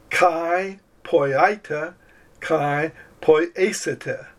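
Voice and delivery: very slow, deliberate Greek phrase; slow Greek reading. Shown as a demonstration. Teacher shows students the verse, very slowly. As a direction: read it out word by word for the class. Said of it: "Here's an example".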